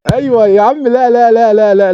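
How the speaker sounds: high and loud joyful yells; excited cheering. Real, not acted. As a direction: cheer the move on. Celebrating a play.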